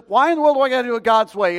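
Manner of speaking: loud, high, hurried protest; mock outrage, rattled off high and loud; indignant rage. Preacher acts out Naaman refusing God's way, indignant. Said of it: "He's raging".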